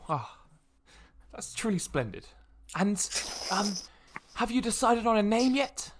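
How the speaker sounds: lightly voiced polite nobleman; a hint of gracious lord, lifting into the question; courteous noble. Game master plays the noble.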